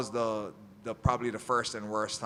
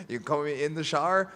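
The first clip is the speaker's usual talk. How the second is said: louder, higher, quick rising question; retold incredulous question; 5 semitones higher, 6 dB louder, faster than usual. Athlete asking tester about shower, loud amused incredulity. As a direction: query it in amused disbelief.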